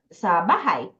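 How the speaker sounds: ordinary delivery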